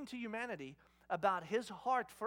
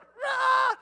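put-on loud high toddler roar; imitated meltdown scream; 14 dB louder than usual. Dad imitating daughter being picked up, meltdown scream. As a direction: scream like a kid losing it. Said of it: "she loses it".

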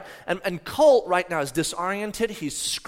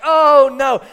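loud, higher, dismayed "oh no"; dismayed cry; 8 semitones higher, 16 dB louder than usual. Retold or imitated. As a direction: cry out in dread as you find your child hurt. Father's alarm acted in retelling.

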